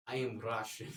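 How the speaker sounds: put-on Russian accent. Kid revealing himself to his father, mock Russian accent. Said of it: "revealed my Russian accent to my father".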